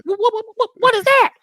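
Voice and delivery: high, stammering squeal of a confused woman; mock-confused patient, way up high; lady patient. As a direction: pitch up high, sputter it out. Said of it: "I go".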